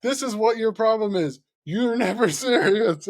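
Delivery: imitating an exasperated coach laying into a player; scolding coach. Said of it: "He, he was like".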